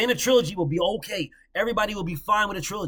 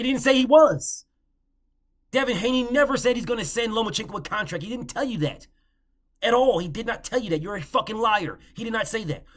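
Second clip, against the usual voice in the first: louder and higher, heated; angry rebuttal; 5 semitones higher, 4 dB louder than usual. Real, not acted. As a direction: call out the lie with heat.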